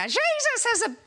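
high, exclaiming Irish punchline; Irish accent. Acted. Irish attendant amazed at BMW design, Irish accent. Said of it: "shall I do the Irish accent".

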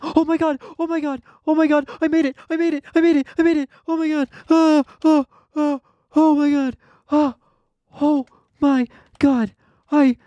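very high, loud shrieks of joy; ecstatic shrieking. Real, not acted. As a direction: squeal it out like you just won everything. Finally clears the hard jump.

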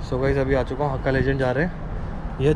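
muffled behind mask; speaking masked outdoors; through a face mask. Real, not acted. Seen 1: Blue surgical mask covers his mouth and nose while speaking.

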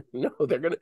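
ordinary delivery

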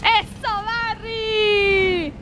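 high, long drawn-out cheer; triumphant cheer. Real, not acted. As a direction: stretch the praise into a squeal. Cheering Barry's success.

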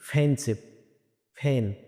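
ফেন্সিভ, ফেন begun with puffed p; slow model words ফেন্সিভ, ফেন; aspirated P. Shown as a demonstration. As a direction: push air out starting ফেন্সিভ and ফেন. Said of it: "এটা শব্দ দাঁড়াবে কি".

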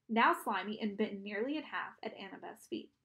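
plain reading voice

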